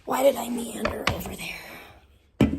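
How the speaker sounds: slow whisper to yourself. Real, not acted. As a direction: murmur it slowly under your breath. Quiet self-talk while moving.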